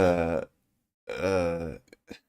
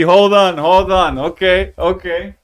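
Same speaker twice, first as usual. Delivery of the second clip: higher, louder, alarmed; startled hold on; 9 semitones higher, 9 dB louder than usual. Real, not acted. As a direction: stop short, spooked. Startled by noise at home.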